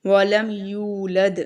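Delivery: वलम यूलद with यू held long and dal bounced; long waw. Shown as a demonstration. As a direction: draw out the u of यूलद slowly. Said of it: "इसको हम थोड़ा लंबा करेंगे". (यूलद में लंबा वाओ)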